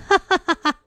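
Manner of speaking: high staccato cackle; long villainous laugh. A narrator's reading. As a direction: throw out a high, mocking ha-ha-ha. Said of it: "老尼姑仰天长笑道". (仰天长笑)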